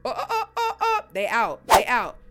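hyped laughing whoops; excited laughing shout. Real, not acted. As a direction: yell they out through laughter. Laughing at ghosting punchline.

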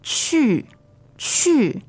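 去 starting high and falling sharply; fourth tone. Shown as a demonstration. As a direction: drop 去 steeply from a high start. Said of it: "fourth tone".

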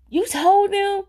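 high-pitched, aghast question; imitated shock. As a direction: mimic a husband's scandalized disbelief. Husband aghast that parents know.

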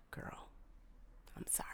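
whispered apology; hushed sheepish sorry. Real, not acted. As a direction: murmur sorry under your breath.

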